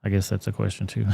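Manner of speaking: usual speaking voice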